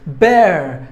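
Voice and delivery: "Bear" punched loud, on its own; stressed syllable. Shown as a demonstration. Teacher showing which syllable takes stress, "Bear" punched. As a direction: hit "Bear" as the strong syllable. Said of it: "should be given more volume".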